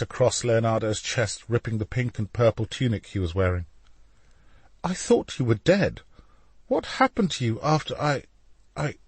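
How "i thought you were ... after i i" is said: higher, faltering question; anxious. A narrator's reading. Leonardo, shaken, asks Alessandro what happened. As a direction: stumble over the words. Startled pleading.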